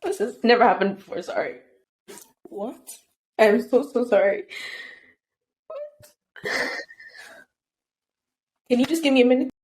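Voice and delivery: high, tearful voice breaking into near silence; overwhelmed, barely getting the words out; getting emotional, real crying. Real, not acted. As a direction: apologise through sudden tears. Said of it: "I'm getting emotional".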